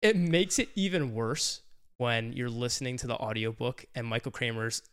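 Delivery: ordinary delivery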